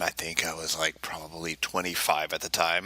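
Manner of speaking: ordinary talk, nothing marked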